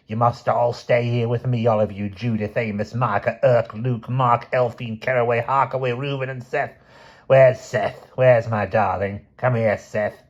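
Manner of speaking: put-on high, quavering old matriarch, wheedling and possessive; a cold old woman clinging to her family; hammed-up old grandmother lament; old grandmother's lament. Aunt Ada's self-pitying tirade.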